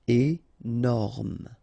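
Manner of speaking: "Énorme" split into é, then norme; syllable by syllable. Shown as a demonstration. French teacher showing learners the parts, "Énorme" syllable by syllable. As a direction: pause after the first syllable of "Énorme".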